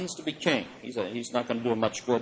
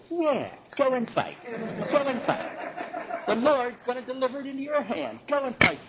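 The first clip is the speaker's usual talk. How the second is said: mock sarcasm; 10 semitones higher than usual. Prophet humoring the king about battle, sarcastic. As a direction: lay the irony on thick, pitched well up. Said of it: "you can call that sarcasm or irony".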